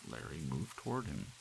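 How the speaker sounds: ordinary delivery, nothing marked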